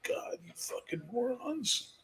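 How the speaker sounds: whispered, slow, disgusted aside; exasperated whisper. Retold or imitated. Muttering to himself about boycott posters, exasperated whisper. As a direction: breathe it out like you can't believe these idiots. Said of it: "And I'm like".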